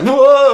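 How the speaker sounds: high, loud rising cry of alarm; mock startled "whoa". Co-host voices Shinji as helicopter crashes, mock alarm. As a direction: imitate a startled boy going "whoa". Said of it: "he's like".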